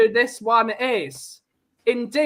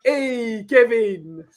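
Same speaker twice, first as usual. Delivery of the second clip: loud, cheeky cartoon-rat impression; punchy character catchphrase, raised voice; Roland Rat; 2 semitones higher, 3 dB louder, much slower than usual. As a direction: call out in the puppet's catchphrase voice. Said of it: "That's a damn good impression".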